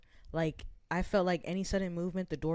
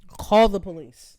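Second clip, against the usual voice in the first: louder, slow "Call the police.", each word pressed; slow loud dare; 13 dB louder, slower than usual. Retold or imitated. Repeating the dare.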